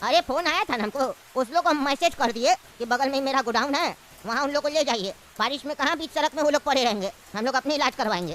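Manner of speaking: put-on low, easygoing old uncle. Uncle explains the godown.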